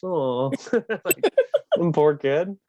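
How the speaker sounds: usual speaking voice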